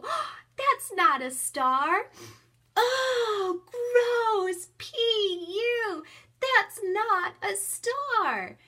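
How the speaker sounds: high, loud and slow, hammed-up "gross, P U"; mock disgust. Shown as a demonstration. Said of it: "And I go".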